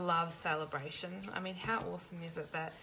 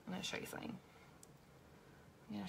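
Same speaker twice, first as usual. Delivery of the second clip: whispering; 10 dB quieter, much faster than usual. Real, not acted. Confiding mistake to viewers.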